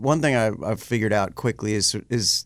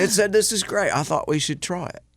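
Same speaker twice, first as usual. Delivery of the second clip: [name] impression; 10 semitones higher than usual. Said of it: "He said".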